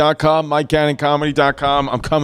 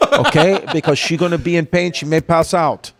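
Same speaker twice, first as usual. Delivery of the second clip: imitated Russian-accented dentist. Dentist warning a father his daughter may pass out, Russian accent. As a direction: warn them in a Russian accent. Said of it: "this Russian woman".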